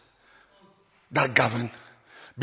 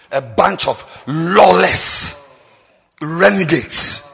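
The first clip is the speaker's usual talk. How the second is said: drawn-out scorn; 8 semitones lower, 5 dB quieter, much slower than usual. Real, not acted. Slow scornful delivery.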